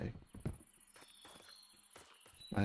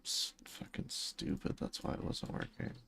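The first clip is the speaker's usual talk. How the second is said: whispered self-scolding; 9 dB quieter than usual. Real, not acted. Gamer whispering curses at own mistake. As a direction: scold yourself under your breath.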